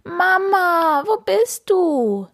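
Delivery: high, slow pleading cry; desperate kitten call. A narrator's reading. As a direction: call out, lost and scared. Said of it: "schreit Karlchen verzweifelt". (verzweifelte, hohe Kätzchenstimme)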